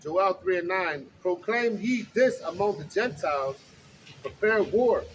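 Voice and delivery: slow, loud preaching read; booming street-preacher reading; scripture reading. Acted. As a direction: proclaim the verses to the crowd. Reads scripture aloud on street.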